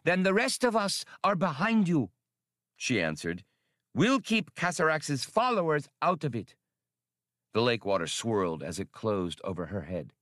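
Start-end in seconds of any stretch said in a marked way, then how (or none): 0.0-6.6 high, alien voice of the female; female swimmer creature. A narrator's reading. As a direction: ask it in a higher creature voice. Female swimmer pledges support.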